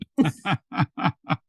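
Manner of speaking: chuckling at her own stubbornness; real laugh. Real, not acted. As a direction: let a string of chuckles out.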